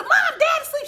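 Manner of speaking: panicked shout. Retold or imitated. Calling mom in panic.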